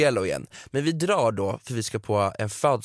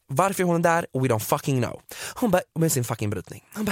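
ordinary talk

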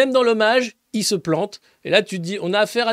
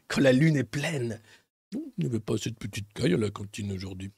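werewolf senator; 8 semitones lower, 5 dB quieter than usual. Pretend. Imagining the senator as a werewolf, low and menacing. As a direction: play a hungry werewolf eyeing prey.

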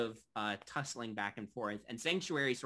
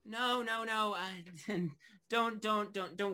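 slightly flustered innkeeper, pitched higher; lightly voiced flustered barkeep; 8 semitones higher, slower than usual. Barkeep declines the regular's offer of help, lightly flustered. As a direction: wave off help with stammered repeats.

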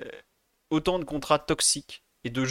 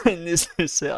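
coughing, choking mid-sentence; choking on a drink; 4 semitones higher, 5 dB louder, slower than usual. Real, not acted. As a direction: splutter through a cough. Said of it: "je m'étouffe". (s'étouffe en toussant)

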